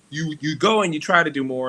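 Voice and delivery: ordinary talk, nothing marked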